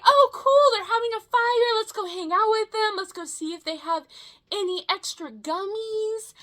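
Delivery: high, bright burst of mock excitement that fades; excited self-quote. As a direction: sound thrilled at the fire, then trail off. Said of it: "and I was like".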